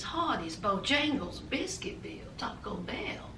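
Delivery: whispered under the breath; whispered aside. Acted. Commentator listing fast-food jobs for Paul, whispered. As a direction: drop to a whisper for the punchline.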